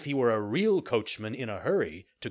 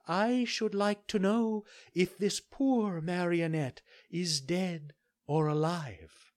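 higher, slow gentle fairy asking; 8 semitones higher, slower than usual. Acted. Fairy asks the doctors.

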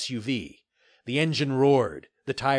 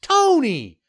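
high-pitched, placating character voice; smiling mayor; 13 semitones higher, 8 dB louder than usual. A narrator's reading. Mayor soothing the angry developer, high and smiling. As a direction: call his name with a smile, high. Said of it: "said with a smile".

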